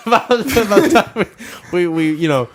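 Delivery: high laughing through words; giggling while trying to talk. Real, not acted. Cracking up mid-sentence.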